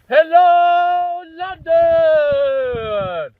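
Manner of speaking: high, very loud stadium shout; rock star shouting to crowd. Pretend. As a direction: yell it to a huge imaginary crowd. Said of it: "I'm gonna treat this like a microphone".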